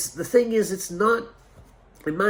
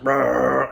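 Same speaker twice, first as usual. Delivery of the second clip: bear growl; 5 dB louder than usual. Retold or imitated. Imitating the trained bear's growl.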